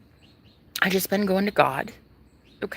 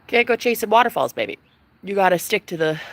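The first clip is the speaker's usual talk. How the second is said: ordinary talk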